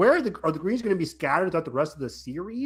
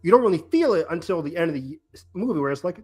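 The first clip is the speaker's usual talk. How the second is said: ordinary delivery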